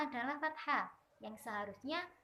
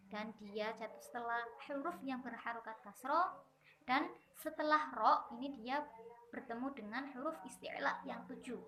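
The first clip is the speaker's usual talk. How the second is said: normal talking voice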